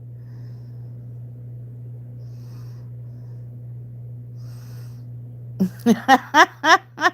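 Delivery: drawn-out real laughter, low and wheezy; long laugh. Real, not acted. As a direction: laugh hard and long at the chat.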